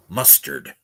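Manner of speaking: low, soft, ominous tag word; radio voice. Pretend. Streamer closing his mock mustard promo, low radio voice. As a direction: drop it low like a suspense announcer.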